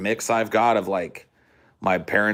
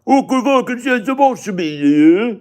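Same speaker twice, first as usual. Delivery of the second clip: loud, high mock British gabble; unintelligible British accent; 10 dB louder than usual. Mocking British viewers' accents as impossible to follow, high garbled British accent. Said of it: "some of you guys' accents are just like".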